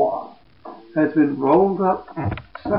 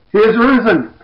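raised, higher proclamation; proclaiming; 9 semitones higher, 7 dB louder than usual. Acted. Angel to the women at the tomb, proclaiming loudly. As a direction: ring it out like a herald.

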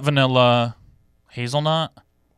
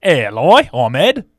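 put-on Australian accent; 5 dB louder, slower than usual. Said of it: "Do an Australian one".

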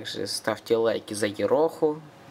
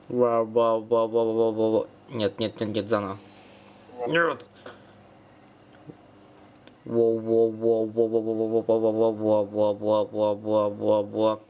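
beatboxing; slower than usual. Shown as a demonstration. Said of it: "щас что-то попробую, я вообще битбокс не очень".

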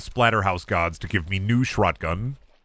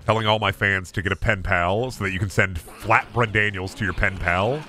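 usual speaking voice

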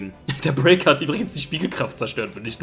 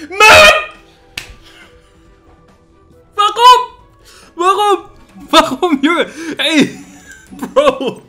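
much higher, louder anguished yelling; frustrated shout; 11 dB louder, much slower than usual. Real, not acted. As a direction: cry out in disbelief at the game. Opponent's surprise defence.

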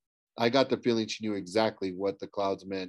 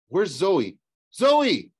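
worried question building to a high yell; alarmed calling; 7 semitones higher, 7 dB louder, much slower than usual. Acted. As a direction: call loudly for a missing friend. Calling out for missing companion.

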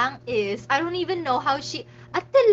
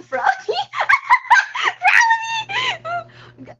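high laughing shriek of the name; real laughing scream; 7 dB louder, much slower than usual. Real, not acted. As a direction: squeal the name through laughter.

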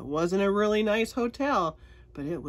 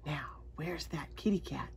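whispering; 4 semitones lower, 9 dB quieter than usual. Real, not acted. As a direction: whisper it like a secret. Hushed search aside to child.

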